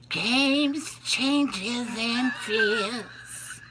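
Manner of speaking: high husky [name] singing voice; [name] impression. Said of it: "I've mimicked it many times".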